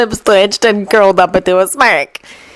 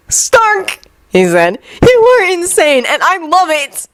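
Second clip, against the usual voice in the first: gleeful Loki; 9 semitones higher than usual. A narrator's reading. Loki delighted after the call.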